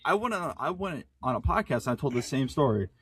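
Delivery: ordinary delivery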